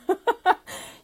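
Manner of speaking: a quick high burst of laughter; bright laugh. Real, not acted. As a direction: laugh it off lightly. Laughs at her own point.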